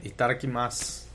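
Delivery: each syllable of "Itadakimasu" said slowly and clearly; teaching "Itadakimasu" to a friend; careful demo. Shown as a demonstration. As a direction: show how "Itadakimasu" sounds, final u nearly silent. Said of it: "Say that more slowly".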